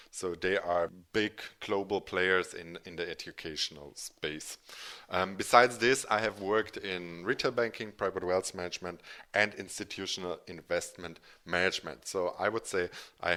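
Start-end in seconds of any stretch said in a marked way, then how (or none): none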